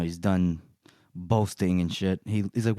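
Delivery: ordinary delivery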